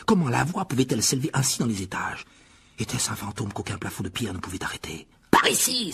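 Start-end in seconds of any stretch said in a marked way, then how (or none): 5.3-5.9 high, loud urgent call; crying out. A narrator's reading. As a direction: shout them along urgently. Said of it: "s'écria-t-il". (cri pressant et aigu)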